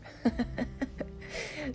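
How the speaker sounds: short breathy chuckle; a quick unvoiced laugh to yourself; soft laugh. Real, not acted. Preacher laughing at his own correction joke, soft chuckle.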